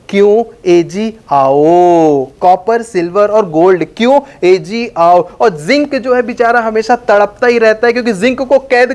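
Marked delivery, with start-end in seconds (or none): 0.1-5.3 low, slow, sweet coaxing voice; a courteous host calling guests in, slowly; lovingly inviting. Acted. Teacher voicing noble metals inviting others, lovingly. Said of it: "ये बहुत प्यार से बुलाते हैं".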